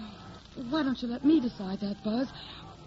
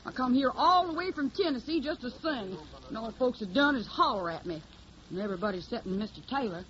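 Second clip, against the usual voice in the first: put-on hillbilly accent. Hildy poses as a Tennessee country girl, in a put-on Southern accent. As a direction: play the naive Southern hillbilly singer. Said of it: "I got the technique down pat".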